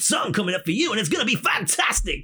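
high, loud, hammed-up radio DJ; radio presenter. DJ welcoming radio listeners, hammed-up presenter voice. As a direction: sell the coming song like a cheesy presenter. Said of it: "on the radio".

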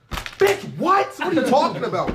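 high, loud, indignant yell; retold shout. Worker yelling back at a rude customer at the drive-thru window, loud. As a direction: snap back at him at full volume.

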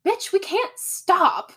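high-pitched imitation of a stressed friend; exasperated urgency. Veronica refuses to stop for food, high exasperated protest. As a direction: protest it high, leaning on the words. Retold protest against stopping.

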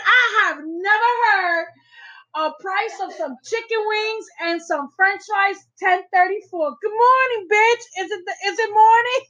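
high, laughing mock rant; imitating the funny guy. Wife acts out the guy complaining about the price, high and laughing. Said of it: "Because he's like".

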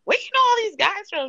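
high, loud incredulous question; playfully acted astonishment; mock amazed friend. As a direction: imitate a friend demanding to know. Voices friends' reaction to pictures.

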